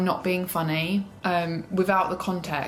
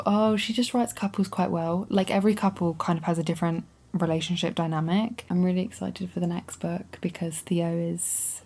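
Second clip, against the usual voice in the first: ordinary talk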